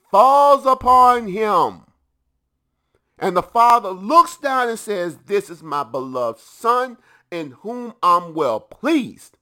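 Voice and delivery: loud, slow preacher's proclamation; the preacher booms the Father's words over the congregation; preaching at full force. Acted. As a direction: build it into a big pulpit cry.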